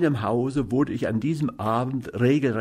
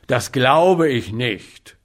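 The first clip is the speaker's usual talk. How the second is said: loud, slow scornful snort; 6 dB louder, slower than usual. A narrator's reading. Man at dinner snorts at the beggar's claim, loud and slow. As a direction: drag it out with disbelief. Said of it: "schnaubte er".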